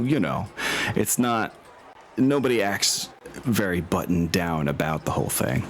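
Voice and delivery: normal talking voice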